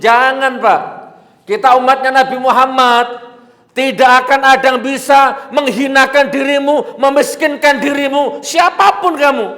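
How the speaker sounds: loud, high preaching at full force; fiery exhortation. Real, not acted. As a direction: rouse the crowd, voice soaring.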